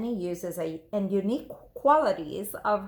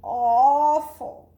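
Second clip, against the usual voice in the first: slow, louder, higher wail of misery; hammed-up childish despair; dramatic despair; 4 semitones higher, 7 dB louder, slower than usual.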